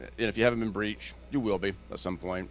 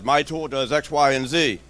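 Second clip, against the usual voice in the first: a touch of a pushy vendor, louder; mock sales pitch; salesy vendor; 5 semitones higher, 6 dB louder than usual.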